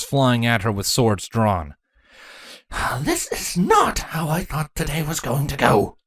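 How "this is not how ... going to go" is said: grumbling. A narrator's reading. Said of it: "Chrysalis grumbled".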